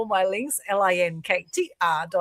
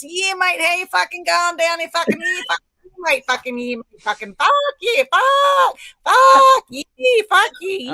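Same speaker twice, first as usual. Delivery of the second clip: high, loud, broad Australian yobbo; yobbo Aussie; 10 semitones higher, 7 dB louder than usual. Acted. Speaker mimicking yobbo Aussies for the group, high Australian yobbo voice. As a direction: squawk it like a mock Australian lout. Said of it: "they say something like this".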